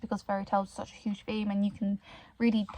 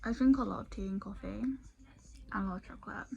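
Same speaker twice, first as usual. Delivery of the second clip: whispering with whitening strips on; with whitening tray; 7 dB quieter than usual. Real, not acted. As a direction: talk around whitening strips. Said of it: "I've got whitening strips on my teeth".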